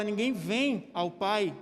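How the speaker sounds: slow, with "vem" weighted; slow deliberate "Ninguém vem ao Pai"; stress on vem. Shown as a demonstration. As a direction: lean on "vem" slowly. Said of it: "Quando você fala".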